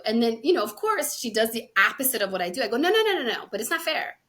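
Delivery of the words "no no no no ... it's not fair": higher, louder burst of objection; mock protest. Retelling her objection to her friend's answer, mock protest. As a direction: object quickly and emphatically, pitched up.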